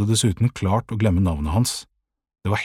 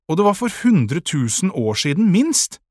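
high, loud retort rising at the end; exasperated comeback; defensive protest; 9 semitones higher, 4 dB louder than usual. A narrator's reading. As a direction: argue back, pitch climbing. Boy keeps arguing back.

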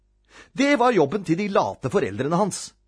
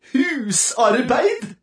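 high, drawn-out word of dread; horrified; 10 semitones higher, 4 dB louder, much slower than usual. A narrator's reading. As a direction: stretch it out in mock horror. Narrator voices boy's horror at word.

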